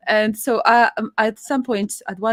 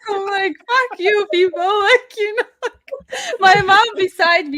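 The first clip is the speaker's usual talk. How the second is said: laughing hard; 11 semitones higher, 6 dB louder than usual. Real, not acted. Laughing hard while retelling.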